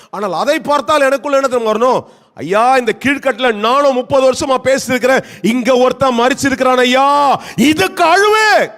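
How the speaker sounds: escalating pulpit lament; impassioned preaching. Acted. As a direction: cry out to the people in protest.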